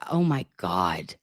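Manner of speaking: low, slow stunned gasp of words. Retold or imitated. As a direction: let it sink in, low. Sudden realization, retold thought.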